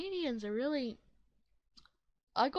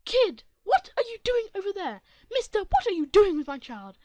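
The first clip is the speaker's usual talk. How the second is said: shrill-loud high scolding mother, much louder; angry mom yelling; 8 semitones higher, 16 dB louder than usual. Acted. Entitled mother yelling at a stranger near her kid. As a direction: bellow it like an outraged mother.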